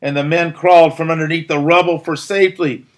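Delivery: usual speaking voice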